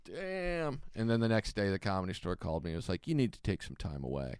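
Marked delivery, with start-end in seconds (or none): 0.1-0.8 higher and much softer, impressed; hushed damn. Retold or imitated. Comic voicing onlookers' reaction, soft and higher. 3.1-4.4 much lower and softer, officious; stern manager. Retold or imitated. Comic imitating the club calling him, lower and softer.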